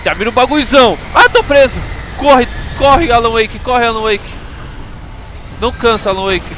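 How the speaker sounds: high, loud panicked yelling; panicked cries while fleeing; being chased. Real, not acted. Enemies chasing in game.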